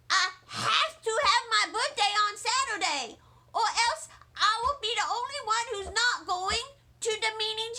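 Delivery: put-on high, loud, protesting kid voice; a child insisting on her way; wailing young girl near tears; upset little girl. Junie protests.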